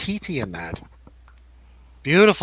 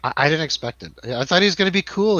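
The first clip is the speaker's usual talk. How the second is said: ordinary delivery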